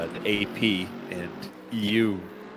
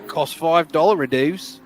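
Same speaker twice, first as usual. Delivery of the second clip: mock Aussie; 7 semitones higher, 5 dB louder than usual. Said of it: "down there".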